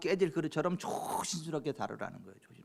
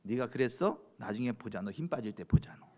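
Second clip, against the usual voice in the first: low, muted mock threat; acted brooding grudge; 6 semitones lower, 3 dB quieter than usual. Someone silently vowing payback on the offender, lightly acted grudge. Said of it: "한을 품지 말고 꿍 하지 말고".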